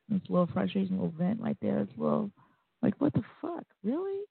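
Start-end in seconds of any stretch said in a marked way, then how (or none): 3.0-4.2 high, disbelieving "really?"; incredulous outburst. Retold or imitated. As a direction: throw it up in sheer disbelief. Speaker's own frustration voiced.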